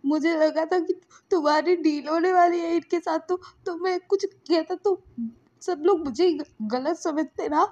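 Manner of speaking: high, halting crying voice; tearful. Acted. Lansan explains to Wing through acted tears, halting and crying. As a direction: choke the words out, acting tearful. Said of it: "वो बोलता है रोते हुए".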